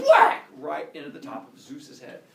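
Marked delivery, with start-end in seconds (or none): 0.1-0.4 sudden loud, high shout; explosive impact shout. Retold or imitated. Storyteller swinging the axe at Zeus, explosive shout. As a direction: land the axe blow with your voice.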